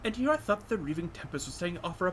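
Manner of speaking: a lightly voiced sneering aristocrat; haughty alien noblewoman. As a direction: purr it out with lazy contempt. Voicing the elegant Xenos lady.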